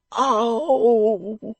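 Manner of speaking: gasp, then a loud high groan; pained groan. A narrator's reading. Beaten man interrupting the dressmaker, long pained groan. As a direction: groan as if writhing in pain. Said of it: "groaned Mister Fledgeby, writhing".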